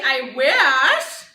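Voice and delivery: very loud comeback; acted retort thrown out at full volume; emphatic retort. Mother topping her son's complaint, loud retort.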